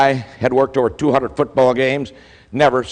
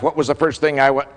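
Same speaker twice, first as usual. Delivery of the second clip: ordinary talk, nothing marked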